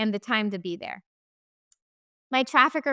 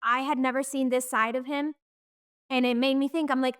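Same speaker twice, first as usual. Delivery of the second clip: ordinary delivery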